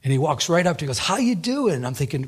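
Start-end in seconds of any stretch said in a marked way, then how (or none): 1.0-1.9 higher-pitched breezy hello; friendly greeting. Retold or imitated. Stranger greets pastor at the coffee counter, friendly greeting. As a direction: greet like an old acquaintance.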